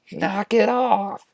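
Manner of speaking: high, drawn-out mock scolding. Obi-Wan smacks and scolds Anakin.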